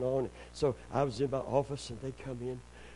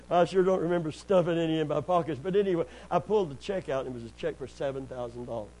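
ordinary talk, nothing marked

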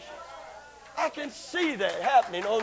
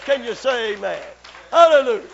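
ordinary talk, nothing marked

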